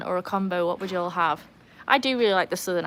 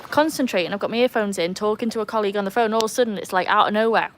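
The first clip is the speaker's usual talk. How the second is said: ordinary talk, nothing marked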